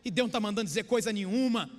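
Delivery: usual speaking voice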